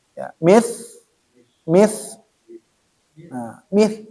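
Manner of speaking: mith with a soft th, tongue between the teeth; correct th sound. Shown as a demonstration. Teacher repeats mith so the student copies it. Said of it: "nah, gitu".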